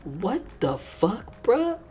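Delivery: high, drawn-out exclamation of real shock; shocked disbelief. Real, not acted. Viewer reacting to Yoko's risqué line, shocked. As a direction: blurt it out, loud and high, appalled.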